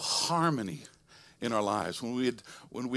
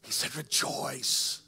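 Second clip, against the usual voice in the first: whispered; much slower than usual. Shown as a demonstration. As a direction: breathe out "Rejoice" in a hush.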